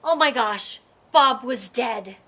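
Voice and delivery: plain reading voice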